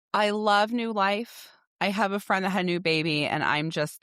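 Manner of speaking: usual speaking voice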